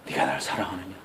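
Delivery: soft, low, gentle asking; tender question. Acted. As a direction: ask him tenderly like a loving master. Jesus asks Peter gently.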